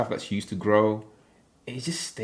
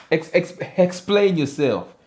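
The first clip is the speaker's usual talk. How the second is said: mock demand; 7 semitones higher, 5 dB louder than usual. Real, not acted. Mock demand at confusing comment.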